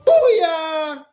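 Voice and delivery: high, loud shout of triumph; triumphant. Real, not acted. Celebrating finding the color, loud shout. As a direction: shout it in victory.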